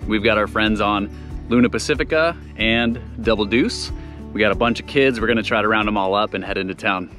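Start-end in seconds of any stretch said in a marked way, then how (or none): none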